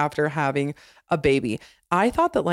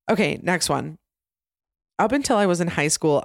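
usual speaking voice

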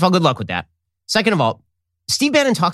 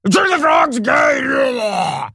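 very high, very slow shouted rant; hammed-up Alex Jones yell; 10 semitones higher, much slower than usual. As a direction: bellow it like a conspiracy radio host. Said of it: "Alex Jones using extreme rhetoric".

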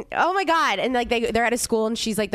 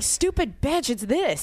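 mock listener yell; 4 semitones higher, slower than usual. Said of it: "People scream at their speakers".

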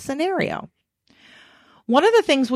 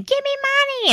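bratty demand. Retold or imitated. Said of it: "bratty women screaming".

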